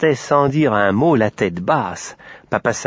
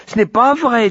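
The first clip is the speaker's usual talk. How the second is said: put-on high, louder girlish protest; indignant child blurting a correction; 13 semitones higher, 5 dB louder than usual. A narrator's reading. Malika contradicts the sun, high and indignant. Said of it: "dit Malika avec indignation".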